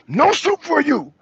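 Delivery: hammed-up slow refusal; slow soup Nazi refusal. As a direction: drag the refusal out, stern and high.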